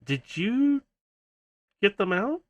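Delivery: high, stretched-out suspicious question; incredulous question. Acted. Kiara questions Howler about the mini cons, incredulous. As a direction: ask it high, dragging out the "you".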